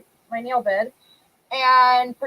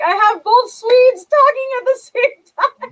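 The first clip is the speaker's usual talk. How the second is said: excited; 11 semitones higher, 6 dB louder than usual. Retold or imitated. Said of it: "And I was like".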